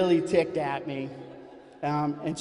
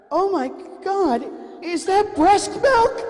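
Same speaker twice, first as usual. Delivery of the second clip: shrieking very high and loud, slowing; mock horrified shriek from the nanny; horrified scream; 9 dB louder than usual. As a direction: shriek it in horror. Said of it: "She's starting to scream".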